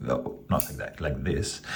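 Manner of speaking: whispered self-correction under the breath; whispered aside. Real, not acted. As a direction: fix your mistake in a whisper to yourself. Self-correcting while demonstrating knife.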